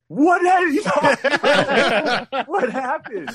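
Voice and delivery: very high, loud, drawn-out squeal of confusion; hammed-up panic, voice shooting way up; panicked reaction. Acting out own panicked reaction to Mike.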